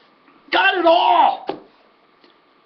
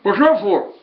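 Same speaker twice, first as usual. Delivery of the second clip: lightly voiced puzzled driver; a hint of Vern, puzzled; lightly voiced Vern asking about the cash; Vern's way of talking. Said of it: "That's how Vern talked".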